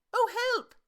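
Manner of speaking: high, loud yelp of alarm; cry for help. Acted. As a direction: squeal as you fall. Pooh falls from branch.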